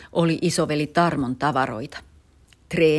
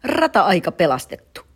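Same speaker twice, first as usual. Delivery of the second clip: triumphant cry; 5 semitones higher, 7 dB louder, slower than usual. Acted. Dad rejoices at found cap.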